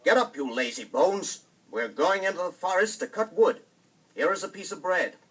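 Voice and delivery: loud, high, harsh wake-up yell; stepmother shouting. Acted. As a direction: bark them out of bed, rushing the end. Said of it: "the stepmother shouted to the children".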